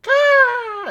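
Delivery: put-on high, loud baby-creature squeak; tiny creature squeal. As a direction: squeal like a tiny griffin chick. Baby griffin surfing a blood wave.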